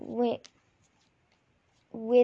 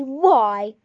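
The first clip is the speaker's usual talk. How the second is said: sharp demand; 10 dB louder than usual. Acted.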